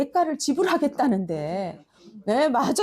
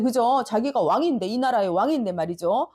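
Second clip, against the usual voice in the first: ordinary talk, nothing marked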